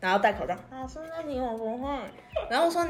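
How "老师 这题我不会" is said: soft, muffled cutesy mumble; mild mock muffled voice; muffled through a mask.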